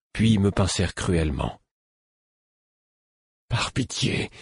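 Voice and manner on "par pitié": higher, breath-thin plea; pleading. A narrator's reading. Patient begging the fool to stop hurting him, pleading. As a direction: beg weakly, almost voiceless. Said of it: "le suppliai-je".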